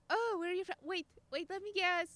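hammed-up guessing game; mock eager guessing. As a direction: blurt out guesses like a nosy stranger. Acts out strangers guessing her origin.

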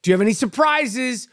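usual speaking voice

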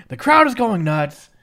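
normal talking voice

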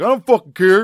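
loud, high imitated snarl; defiant. Jane answering Farnham about the room, defiant. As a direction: snap it back in a mock holler. Voicing Jane's defiant reply.

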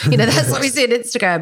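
a laughing jab at lunch photos. Real, not acted. Host laughing as she mocks Instagram food posts, laughing.

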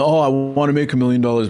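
quick, airy wishful-thinker impression; mock dreamer voice. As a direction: toss it off like a daydreamer.

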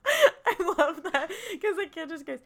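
ordinary talk, nothing marked

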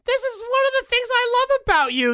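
high, bright gushing praise. Real, not acted. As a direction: gush over him fondly. Fond praise of husband.

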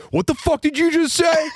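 high-pitched indignant dad outburst; hammed-up incredulous anger; mock outrage. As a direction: act out an outraged father, pitch way up. Retold father's outraged challenge to heckler.

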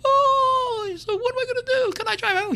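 high falsetto worried wife; very high mock-panicked wife voice; wife's voice. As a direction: squeak it out like a fretting wife. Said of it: "His wife's like".

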